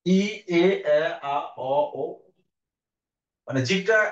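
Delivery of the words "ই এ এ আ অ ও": vowels called out louder in order; vowel circle demo. Shown as a demonstration. Teacher loudly modelling the vowel circle. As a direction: sound each vowel as the tongue moves. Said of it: "বৃত্তের মতো".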